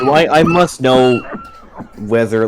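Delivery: words through a laugh. Real, not acted. Player laughs while asking GM about the kids.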